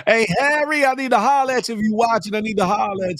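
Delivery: calling out. Real, not acted. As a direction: hail him across the room. Calling out to Harry.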